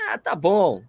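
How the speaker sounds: ordinary talk, nothing marked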